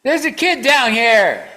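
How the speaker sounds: high, loud shout imitating excited kids; acted-out child's yell; kids calling out. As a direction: holler it long and high to others far off. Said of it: "I heard these kids going".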